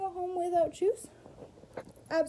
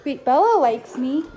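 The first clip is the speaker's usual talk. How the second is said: loud cooing to a pet; 14 dB louder than usual. Real, not acted. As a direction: gush over the dog. Greeting the dog.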